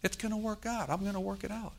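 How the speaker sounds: high-pitched, gentle insistence; soft-edged high reassurance; reassuring God. Acted. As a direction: promise it tenderly, pitched well up. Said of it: "He says".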